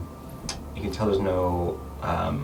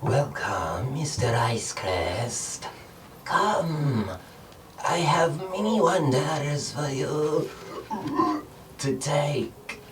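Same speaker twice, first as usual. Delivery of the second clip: slow, higher creature voice with a gurgle; guttural creature; 8 semitones higher, much slower than usual. Acted. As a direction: drawl it out like a slimy thing. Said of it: "strange guttural noise in the voice of this creature".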